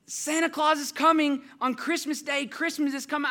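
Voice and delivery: high, loud, thrilled child imitation; excited three-year-old. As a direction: announce big news like a little girl. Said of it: "She's three".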